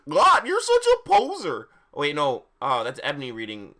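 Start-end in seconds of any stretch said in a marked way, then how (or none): 0.1-1.7 very high, loud cartoon voice; hammed-up silly character yell; Goofy. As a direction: shriek it like a cartoon goofball. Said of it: "Hagrid's voice was Har-, uh, Goofy".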